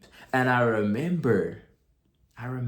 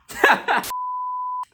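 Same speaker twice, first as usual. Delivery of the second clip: a high, louder, breathy laugh; high burst of laughter; 6 dB louder than usual. Real, not acted. Admitting his old rude wish to viewers, laughing. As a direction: laugh at yourself, caught off guard.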